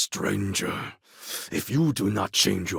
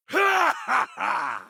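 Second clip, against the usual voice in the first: shouted, much higher falling laugh; a wild victorious cackle; roaring laugh. Acted. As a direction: throw your head back and roar.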